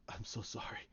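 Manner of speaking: soft, low, near-whispered sorrow; hushed apology. Acted. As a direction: murmur it weakly and low. Luke apologizes, dying.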